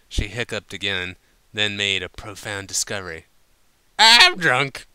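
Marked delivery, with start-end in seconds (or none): 4.0-4.9 loud, higher proud declaration; announced drunkenly. A narrator's reading. Applejack announcing her drunkenness, loud and proud. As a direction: proclaim it like a great discovery. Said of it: "she announced".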